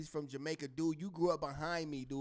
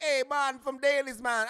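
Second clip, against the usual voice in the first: friend putting on a put-on island accent; lightly hammed-up Jamaican greeting, a bit higher; mock Jamaican accent; 8 semitones higher, 6 dB louder than usual. Friend from the block faking a Jamaican accent, mild mock Jamaican. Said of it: "act like he Jamaican".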